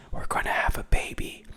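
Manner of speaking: soft breathy secret whisper; hushed confession of pregnancy. Retold or imitated. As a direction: whisper it close, like a secret to Harvey. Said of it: "And she just whispers".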